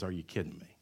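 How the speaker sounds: Jesus answering the Pharisees, wearily exasperated; weary sigh. Retold or imitated. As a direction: say it with a tired sigh. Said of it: "And Jesus sighs".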